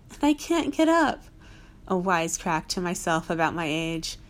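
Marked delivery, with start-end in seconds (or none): 0.1-1.2 high, loud mock helpless old lady; joking old lady. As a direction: cry out like a fallen granny.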